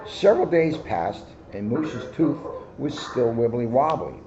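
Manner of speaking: ordinary delivery, nothing marked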